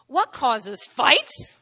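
"fights" stretched with a big rising tone; questioning. Shown as a demonstration. Preacher reading James to the church, exaggerated question with "fights" rising. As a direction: read slowly, swinging up sharply on "fights". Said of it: "read that like a question".

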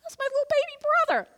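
a light high squeal, quick; fond sister's aside. Retold or imitated. Sister reacting to her brother's confession, lightly high and quick. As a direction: squeak it out fondly. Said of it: "he started telling me the worst".

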